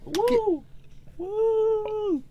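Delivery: high falsetto woo; victory whoop. Real, not acted. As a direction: whoop like you won.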